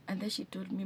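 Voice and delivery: ordinary delivery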